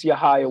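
Yahaya said slowly as ya-HIGH-ya, stress on the middle; correcting his name. Shown as a demonstration. Guest correcting the host on his surname, careful and slow. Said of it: "Um, no".

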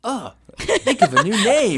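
high, loud, slow complaint; exasperated outburst. Real, not acted. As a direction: throw your hands up at the name. Complaining about album name.

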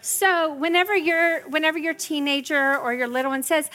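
normal talking voice

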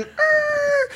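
very high, drawn-out tire squeal; imitated brake screech. Girlfriend's car slamming on her brakes, imitated screech.

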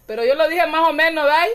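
usual speaking voice

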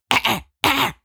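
mimicking dad's warning. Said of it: "You go".

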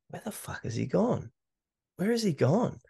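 mildly panicked, pitch jumping up; upset and stressed. Retold or imitated. Runner finds his buddy has vanished, upset and stressed. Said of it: "started to get really upset and stressed".

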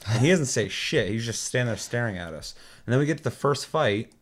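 normal talking voice

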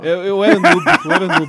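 laughing. Real, not acted. Joking about being a noob.

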